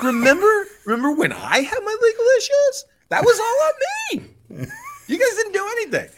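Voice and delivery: very high, loud whiny impression; hammed-up wounded griping; mock whine. Owner whining that nobody helped with his legal issues, high mock whine. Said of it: "then he basically whines".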